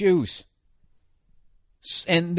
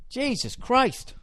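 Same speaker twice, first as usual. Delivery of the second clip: laughing at himself; 7 dB quieter, slower than usual. Real, not acted.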